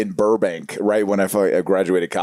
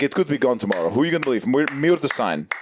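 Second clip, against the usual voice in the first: put-on Armenian salesman accent; 5 semitones higher than usual. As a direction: lean in like a smooth Armenian salesman pitching. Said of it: "my Armenian buddy, Armin".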